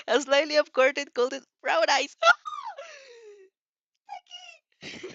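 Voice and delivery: reading through a loud burst of laughter; real giggling fit while narrating; laughing. Real, not acted. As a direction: laugh the words out, can't hold it in. Reader cracks up mid-narration.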